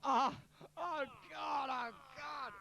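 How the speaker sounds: high wail at a bad joke; groaning dismay. Real, not acted. Host reacts to Rachel's joke, exaggerated groan.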